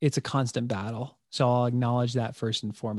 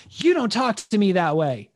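a hint of a raised stern dad voice; the scolding he used to snap out, pitched a bit higher; 7 semitones higher, 4 dB louder than usual. Scolding his four-year-old son, mildly stern and raised. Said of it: "instead of doing".

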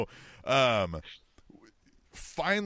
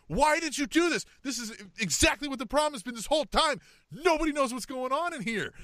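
exasperated wife; 11 semitones higher than usual. Retold or imitated. Wife scolding the sales manager over the truck, mock exasperated. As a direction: voice the wife's fed-up rant at the dealer.

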